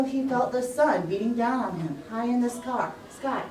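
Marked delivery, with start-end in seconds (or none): none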